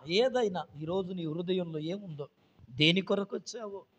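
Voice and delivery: quiet after the peak; sudden hush. Acted. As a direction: pull back to an intimate hush. Sudden drop after the shout.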